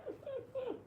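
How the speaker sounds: high, soft helpless laugh; high quiet laughing. Real, not acted. Tickled by her own gassy dog story, soft high laughing.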